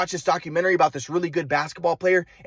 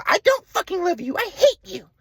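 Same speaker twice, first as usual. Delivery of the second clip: much higher mock-mom outburst; angry mom; 13 semitones higher than usual. Mom rejecting her son on the phone, high and hateful. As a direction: spit out the rejection as a high-voiced furious mother.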